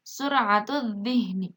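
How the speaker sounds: slow "sur'atul dhihni", L merged into held DH; silent lam. Shown as a demonstration. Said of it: "Itu juga sama".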